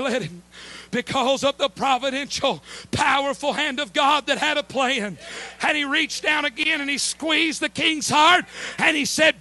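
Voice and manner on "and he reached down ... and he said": rising preacher shout. Acted.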